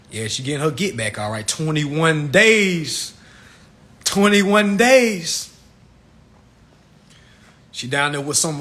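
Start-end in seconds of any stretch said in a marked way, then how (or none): 1.5-5.5 very slow, drawn out; stretched disbelief. Acted. Comic mocking a twenty-one-day trip, drawn out. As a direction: savor every syllable in disbelief.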